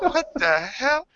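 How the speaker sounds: high, slow mom voice; slow, high-pitched incredulous question; baffled mother. Retold or imitated. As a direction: imitate a mom drawing out her disbelief. Mother's baffled reaction retold.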